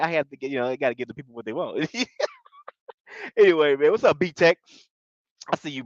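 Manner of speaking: ordinary talk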